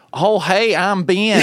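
high, hammed-up Southern twang; mock Southern accent. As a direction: imitate a bad mimic's Southern accent. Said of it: "when they try to mimic me".

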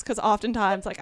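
ordinary talk, nothing marked

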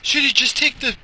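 high, slow, whiny worried voice; mock fretting wife. Wife fretting about son's flight, mock high whine. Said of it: "She's like".

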